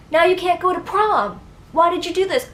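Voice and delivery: mom scolding. Retold or imitated. Mom confronting her child about the short hair, loud and upset. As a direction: cry out in dismay at the haircut.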